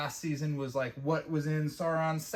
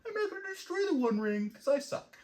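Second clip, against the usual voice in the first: much higher, whimpering mock-whine; whiny Isildur. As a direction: sneer at a weak man by whining his excuse. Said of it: "Isildur's like".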